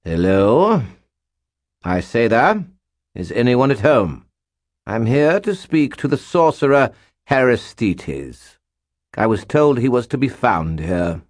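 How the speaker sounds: British nobleman hailing an empty hall; hammed British aristocrat calling out; calling out in British accent. A narrator's reading. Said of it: "and then called out".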